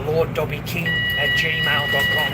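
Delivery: each part of the address spelled out slowly; slow it down so viewers can copy it; slow dictation. Real, not acted. Reading his email out to viewers, slow and deliberate.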